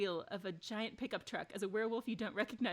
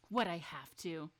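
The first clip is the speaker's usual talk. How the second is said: normal talking voice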